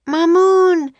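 high, loud drawn-out call; shouting. A narrator's reading. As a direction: shout for a missing companion. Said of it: "they shouted together".

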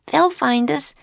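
put-on high girlish worry; a little sister's anxious warning; young girl worried. A narrator's reading. Annie warns Jack the enchanters will find them, worried. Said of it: "said Annie".

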